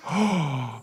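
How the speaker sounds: big storytelling gasp of surprise; shocked gasp. A narrator's reading. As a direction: gasp as if stunned. Squirrel agrees, reader gasps in shock.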